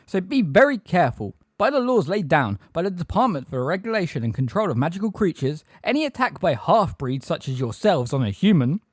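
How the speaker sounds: high-pitched officious warning. A narrator's reading. Umbridge warning centaurs about the law, high officious voice. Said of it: "in an even higher voice".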